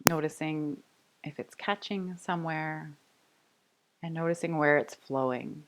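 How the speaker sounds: soft, unhurried meditation voice with long pauses; guided meditation. Real, not acted. Yoga teacher inviting the class to rest, calm guided meditation. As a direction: settle them gently into noticing the breath.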